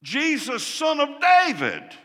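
loud, high desperate call; crying out. Retold or imitated. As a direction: cry out for Jesus to hear. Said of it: "So he cried out".

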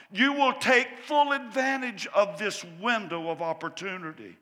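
loud, rising preacher's call; full-force preaching. Acted. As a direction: preach it hard to the congregation. Preaching build-up to the point.

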